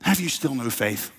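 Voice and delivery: gentle disappointment. Acted. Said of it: "And Jesus kinda".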